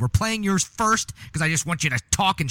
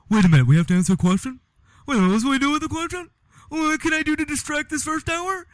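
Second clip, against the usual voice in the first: high, fast, whiny mock of the cohost; mock him with a fast, high voice; mocking whiny imitation; 12 semitones higher, 3 dB louder than usual. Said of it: "you go".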